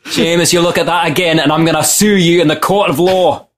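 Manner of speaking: mock-threatening Scot with a laugh; Scottish accent. Threatening to sue young Seamus, comic Scottish accent. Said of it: "funnier than a Scottish accent".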